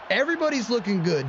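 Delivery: ordinary talk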